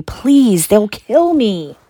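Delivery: slow, strained desperate croak; croaked plea. A narrator's reading. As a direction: croak it out slowly, begging. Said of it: "croaked Harry".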